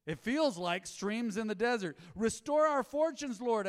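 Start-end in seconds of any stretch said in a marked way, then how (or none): 2.1-3.7 high, loud, slow plea; sermon proclamation. Acted. Pastor reading the psalm aloud to the church, slow and lifted. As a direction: cry it out slowly like a prayer.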